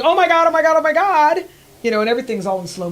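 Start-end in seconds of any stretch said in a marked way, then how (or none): 0.0-1.4 loud, high panicked cry of oh my God; retold scream. Rider screaming while thrown over handlebars, high and loud. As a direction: relive the terror of flying over the handlebars.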